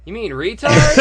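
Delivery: loud, high punchline; comic's blunt retort. Retold or imitated. Comic shooting back at his critics, loud mock disbelief. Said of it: "And he's going".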